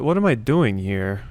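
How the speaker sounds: usual speaking voice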